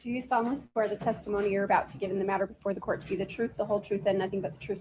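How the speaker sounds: ordinary delivery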